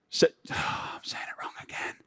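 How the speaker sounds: unvoiced whispered aside. Real, not acted. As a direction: whisper it to yourself. Muttered aside after a slip.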